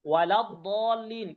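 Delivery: ওয়ালা দলিন said slowly and smoothly; slow recitation demo. Shown as a demonstration. Teacher recites ওয়ালা দলিন slowly to show flow. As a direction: draw out ওয়ালা দলিন as in the Quran. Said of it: "কঠিন লাগছে দদ উচ্চারণ করতে?".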